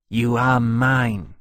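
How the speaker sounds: slow, loud, stubborn claim; obstinate. A narrator's reading. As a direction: dig in stubbornly, drawing the words out. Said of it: "Temeraire said obstinately".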